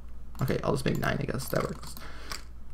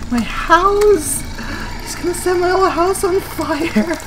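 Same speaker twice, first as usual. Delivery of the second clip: high, loud, despairing scream; anguished scream; 8 dB louder, slower than usual. Real, not acted. Gamer watching her house burn, anguished scream. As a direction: cry out for the lost house.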